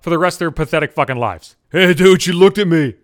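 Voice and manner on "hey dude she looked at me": loud, bragging frat-bro voice; hamming up a boastful jock; mock macho dude. Mocking a macho guy bragging that [name] glanced his way, loud bro voice. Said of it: "these douchebag macho assholes".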